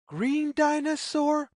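usual speaking voice

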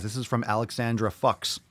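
ordinary talk

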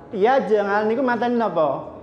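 normal talking voice

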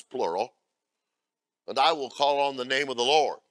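loud, declaiming Bible reading; scripture reading. Acted. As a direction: proclaim the verses from the pulpit. Reads scripture in preaching voice.

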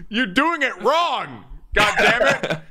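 high, drawn-out exasperation with a laugh; exasperated. Real, not acted. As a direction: complain loudly through laughter. Exasperated at the mimicry.

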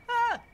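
sudden scared shriek; startled yelp. Real, not acted. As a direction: yelp in fright. Startled by jump scare in game.

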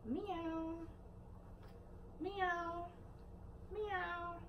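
high soft meow; cat meow. Pretend. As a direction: mew like a little kitten for the baby. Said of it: "It's a cat".

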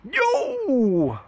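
high, stretched "yo" of triumph; excited yo. Real, not acted. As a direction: whoop at a winning kill. Gets the last kill.

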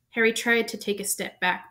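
ordinary delivery, nothing marked

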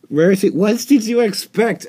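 loud, high exasperated outcry; exasperated shout. Real, not acted. Player yelling at Rarity on screen, exasperated shout. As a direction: throw the question at her, fed up. Exasperated outburst at game character.

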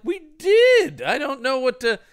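high-pitched, helpless imitation; mock frantic protest. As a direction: protest in a hammed-up fluster. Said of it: "And they're like".

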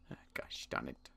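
ordinary talk, nothing marked

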